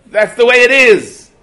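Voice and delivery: very high, very loud, slow shout; furious yell. Retold or imitated. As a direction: roar it while grabbing his lapels. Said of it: "he yelled".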